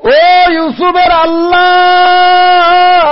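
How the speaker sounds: high, loud, long-held wail; wailing cry to God. Acted. Zulaikha by the road crying out to God, wailing. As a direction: cry out and stretch the last vowel. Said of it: "কান্দার বলে".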